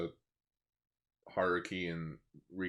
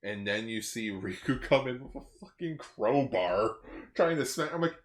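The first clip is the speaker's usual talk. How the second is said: ordinary talk, nothing marked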